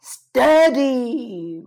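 loud, put-on bull voice; big drawn-out animal roar. A narrator's reading. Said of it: "roared Bernie".

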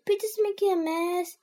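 high, whiny complaint; tattling child. A narrator's reading. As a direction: tell on your brother. Henry tells on Peter.

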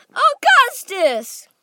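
very high, loud, drawn-out cry; frantic shout of the boy's name. A narrator's reading. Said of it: "cried Mister Wonka".

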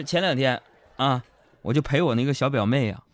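ordinary talk

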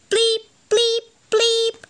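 high electronic bleep sounds; computer bleeps. Acted. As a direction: bleep like a game console. Computer noise in story.

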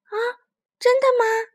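high, eager little voice; excited child voice. Acted. Children eager to see spring.

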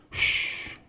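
whispered shush; hushing. Acted. Tiaotiao to Bengbeng, whispered shush. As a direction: hush him sharply.

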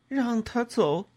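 high, slow, anguished plea; pained high cry, drawn out; broken, pained voice. A narrator's reading. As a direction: drag the words out in pain. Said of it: "我用断断续续、 痛苦的声音说".

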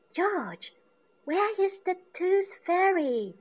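put-on piggy girl wondering aloud; Peppa asking. A narrator's reading. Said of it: "Peppa asks".

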